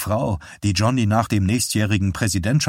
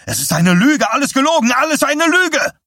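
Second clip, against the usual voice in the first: much higher and louder, raging scream; 6 dB louder than usual. Acted. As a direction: scream the accusation, voice cracking up. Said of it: "schrie Dussault ihn an".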